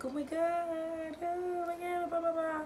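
high, drawn-out pretend amazement; hammed-up, high and slow swoon; mock surprise. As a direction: stretch it out in a high squeal of fake surprise. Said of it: "I can be surprised".

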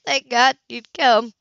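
slow, breaking, tearful relief; barely holding back tears; voice cracking. Acted. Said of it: "Her voice cracked".

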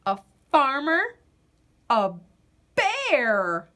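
ordinary delivery

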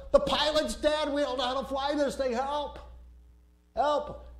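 high, panicked cry for help; panicked plea. Retold or imitated. Passenger begging the airliner for help, panicked. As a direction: plead over the radio in a panic. Panicked call over radio.

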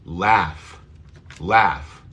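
crisp model "laugh", short A. Shown as a demonstration. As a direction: laugh with front open A as in cat. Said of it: "Vamos a pronunciar la A corta".

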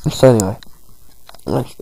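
usual speaking voice

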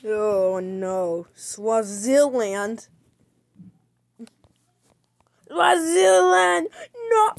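loud, drawn-out mock wail; hammed-up grief for a lost colony. Player mock-wailing over losing Swaziland.

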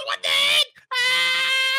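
high, loud startled yelp; scared yelp. Real, not acted. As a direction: shriek in fright. Attacked again.

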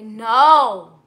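loud, drawn-out no; a sudden loud, emphatic no; emphatic denial. Real, not acted. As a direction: shoot the question down loudly. Emphatic denial answering a viewer's question.

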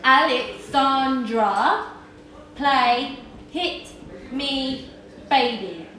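words spaced out very slowly; slow, deliberate command. Acted. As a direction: spell it out slowly for the machine. Slow repeated command to assistant.

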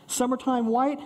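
ordinary talk, nothing marked

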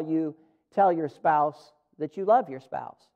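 ordinary talk, nothing marked

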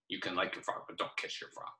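put-on teacher's secret joke to kids; whispered joke. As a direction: whisper the warning, no voice. Joking warning to kids.

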